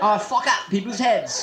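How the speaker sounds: slow, put-on British accent; drawn-out hammed-up British voice; British hairdresser. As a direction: confess slowly as a mock-British hairdresser. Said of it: "I'm a British hairdresser".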